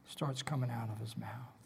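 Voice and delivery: softly whispered echo; hushed repeat. Shown as a demonstration. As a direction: drop to a whisper. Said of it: "first said aloud".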